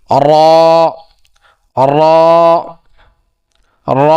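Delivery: র with the back of the tongue tip touching the palate; Arabic ro. Shown as a demonstration. Teacher models র with tongue tip against palate, full "ro". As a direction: sound each র full and round, "ro". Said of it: "তার বরাবর উপরে তালুর সঙ্গে লাগাইয়া".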